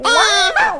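high loud wah wah; baby wail. Acted. Puppy hearing the new baby, loud baby wail. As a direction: wail like a newborn. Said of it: "Biscuit第一次听到这种声音".

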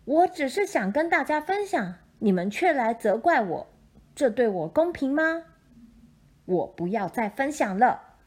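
loud, hurt and angry mother. Acted. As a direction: scold them, fed up and hurt. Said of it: "河马妈妈生气了".

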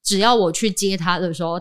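normal talking voice

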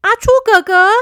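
high, loud excited shout of a name; loud call. Acted. Boy spotting his friend shouts his name loudly. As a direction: call out across to a friend with joy. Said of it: "他一看到他就大声地说".

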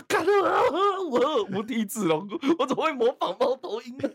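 high, loud hammed-up wail with laughter; mock shame. Shamed by her words, wailing high and loud. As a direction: cry out in exaggerated shame.

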